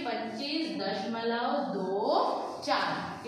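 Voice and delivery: दो चार said as separate digits, slowly; slow careful reading. Shown as a demonstration. Teacher modelling the correct reading of the decimal, slowly. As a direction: spell the decimal out slowly for the class. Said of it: "इसको चौबीस नहीं पढ़कर क्या पढ़ेंगे".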